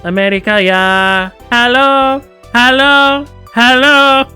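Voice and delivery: loud, high, drawn-out greetings; over-the-top high, loud imitation; meme chorus of hellos. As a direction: belt out each hello like a goofy chorus.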